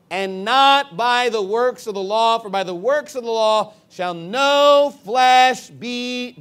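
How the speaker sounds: not and no drawn out loud; slow, booming verse, swelling on no flesh be; emphatic scripture reading. Shown as a demonstration. Hammers the verse while reading.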